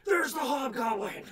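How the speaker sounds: loud alarmed shout; shouted announcement. Acted. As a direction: yell it out to everyone. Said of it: "Announcing it".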